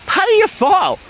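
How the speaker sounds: storyteller acting out a kid's question; retold question. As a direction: call the question up high, retelling it.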